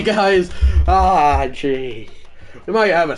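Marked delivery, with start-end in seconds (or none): none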